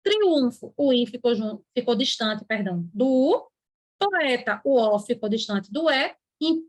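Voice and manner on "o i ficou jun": ordinary talk, nothing marked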